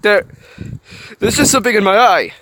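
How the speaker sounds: loud defensive excuse; flustered shout. Acted. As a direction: bluster to hide crying. Anger hiccuped.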